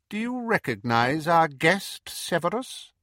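high, cold, silky menace; sinister dark lord; Voldemort voice. Acted. Voldemort presents captive.

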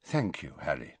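soft, falling thanks; quiet. A narrator's reading. Headmaster thanks Harry after the memory, quiet. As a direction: say it quietly, dropping away. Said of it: "said Dumbledore quietly".

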